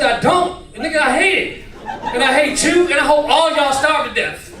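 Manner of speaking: high, loud indignant rant; angry outburst. Retold or imitated. Beaten guest answering his homeboy, angry outburst.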